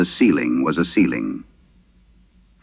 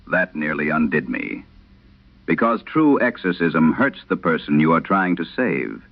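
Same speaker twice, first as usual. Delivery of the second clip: ordinary delivery, nothing marked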